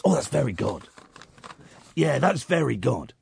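low, quiet mock agreement; sarcastic mumble. Real, not acted. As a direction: say it under your breath, dripping sarcasm. Sarcastic repeat of lyric.

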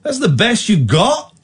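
high, drawn-out disbelieving questions; incredulous challenge. Real, not acted. Host challenging a rude caller, incredulous.

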